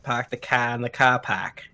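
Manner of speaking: Park the car with no R; New England accent. Shown as a demonstration. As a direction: drop every R, New England style. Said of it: "My family's from New Hampshire".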